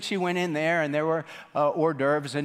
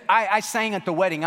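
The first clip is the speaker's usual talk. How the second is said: higher, louder, stammering protest; flustered protest; 6 semitones higher, 5 dB louder than usual. Retold or imitated. Guest protesting to the doorman, flustered and higher. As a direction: plead her case, rattled.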